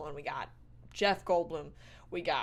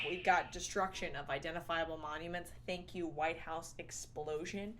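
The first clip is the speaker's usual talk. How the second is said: ordinary delivery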